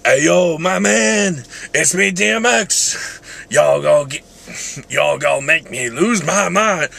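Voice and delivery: rough attempt at a shouting rapper; hammed-up rapper impression, stretched words. Vlogger trying a rapper impression, drawn-out shouting. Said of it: "probably not the best impression".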